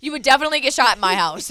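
loud playful warning about shooting intruders; loud emphatic. Real, not acted. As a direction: declare it loudly with a laugh behind it. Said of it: "Let that be known".

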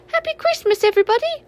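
high sleepy little creature's cheer; tiny creature. A narrator's reading. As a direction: squeak it out like the smallest animal.

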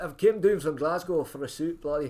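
loud, high mock-Scottish voice; Scottish accent. Imitating a big fella from Glasgow after a suit, loud Scottish accent. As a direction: boom it out in a put-on Scottish accent. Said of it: "in his big Scottish accent".

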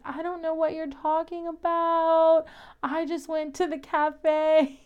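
put-on clueless innocence; mock a witness feigning total ignorance; playing oblivious. Imitating the witch denying it to Sam, playing oblivious. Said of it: "playing all oblivious".

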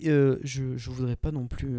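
ordinary delivery

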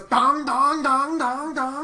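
high sung dongs; imitated gong. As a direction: ring it out like a bell. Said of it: "it just goes".

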